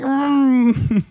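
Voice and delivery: high, loud mock "mm"; imitated hum. Streamer imitating clueless viewers, high mock hum. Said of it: "and you're like".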